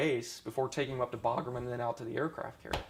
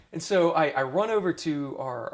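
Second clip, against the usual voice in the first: ordinary talk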